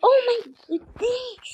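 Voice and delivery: high loud shocked shriek; shocked cry. Real, not acted. Gamer shrieking at the screen in shock.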